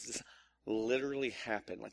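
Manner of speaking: ordinary talk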